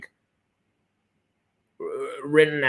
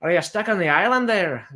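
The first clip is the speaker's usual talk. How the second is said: put-on playful Irish accent, raised pitch; 4 semitones higher than usual. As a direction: ask it in a put-on Irish lilt. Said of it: "using the Irish accent".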